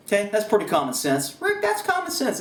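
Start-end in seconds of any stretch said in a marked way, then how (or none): 1.4-2.4 lightly voiced high, snarky viewer; mock viewer retort. Imagined viewer tells Rick it's obvious, higher and snide. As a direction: toss it back like a know-it-all viewer.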